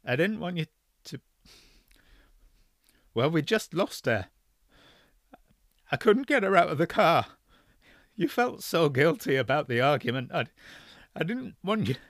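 faltering. Acted. Father to son on phone, faltering confession. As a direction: voice faltering toward tears. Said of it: "the voice came back, faltering".

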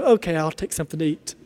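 low, soft, quick acceptance; giving in. Pretend. Guest accepts on the third offer, quietly giving in. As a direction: relent quietly, dropping the voice.

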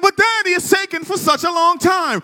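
put-on whiny child. Son begging his daddy for the T-Rex now, whiny. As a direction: whine it like an impatient little boy. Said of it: "He said".

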